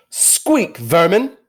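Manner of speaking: high, loud sneer at vermin; a queen scorning small creatures, high and loud; contemptuous witch. Acted. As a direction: spit the insult down at them. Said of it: "she said again".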